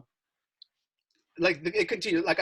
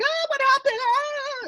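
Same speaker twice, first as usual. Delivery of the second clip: put-on much higher shrieking girl voice; mock screaming; 5 dB louder, slower than usual. Girls see the boys bleeding and shriek, much higher panicked squeal. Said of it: "they're, like, screaming".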